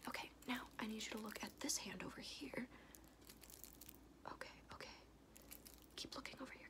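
ordinary talk, nothing marked

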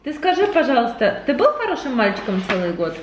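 normal talking voice

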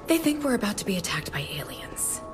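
hushed warning. Acted. Aide tells the president of coming alien attack, hushed. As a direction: break it quietly and gravely. Aide breaks grave news quietly.

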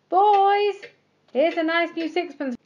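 high, loud call from the window. A narrator's reading. Jeremy calls to the boys from the window, loud and high. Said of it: "called out".

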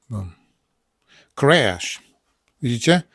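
normal talking voice

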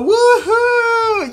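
high, drawn-out cheer; excited whoop. Pretend. Kids setting off for Bikini Bottom, high excited whoop. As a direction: whoop as the adventure starts.

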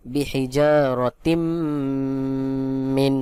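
syllable-by-syllable recitation with a sustained final m in তিম; merged nasal reading. Shown as a demonstration. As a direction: hum long on the m of তিম before মিন. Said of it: "এখানে ইদগাম করে পড়তে হবে".

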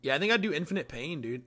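ordinary delivery

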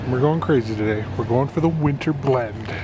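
ordinary talk

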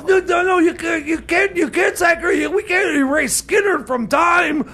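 high, urgent insistent protest; panicked protest. Acted. Horrified at sacrificing Skynyrd.

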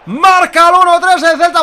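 long loud goal call; spanish goal commentary. Real, not acted. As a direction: belt out the goal. Goal called.

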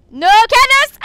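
very high, loud shriek; yelled protest. Real, not acted. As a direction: scream the objection at your opponent. Repeats it as panicked yell.

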